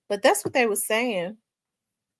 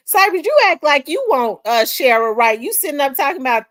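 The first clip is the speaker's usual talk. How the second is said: high, loud playful call-out; loud teasing; 6 semitones higher, 8 dB louder than usual. Real, not acted. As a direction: call him out loudly and teasing. Teasing Cypress on live.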